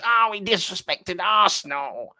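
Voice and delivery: mock the moaning fans, dragging it out; sarcastic impression of an offended supporter; mocking outraged fan. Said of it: "There's certain fans".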